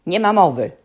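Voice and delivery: loud, sudden roar; booming refusal. A narrator's reading. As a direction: boom it out. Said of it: "gruchnął Wyrwigrószka".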